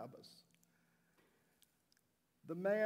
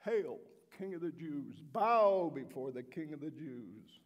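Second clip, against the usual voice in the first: mocking; 9 dB louder than usual. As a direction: sneer it out as a mock salute. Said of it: "they began to mock him".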